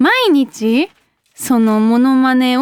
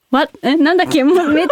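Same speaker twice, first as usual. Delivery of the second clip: ordinary talk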